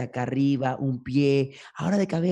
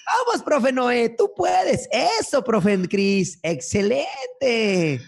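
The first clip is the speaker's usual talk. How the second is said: cheering; 9 semitones higher, 4 dB louder, much slower than usual. Acted. Cheering on teachers at events.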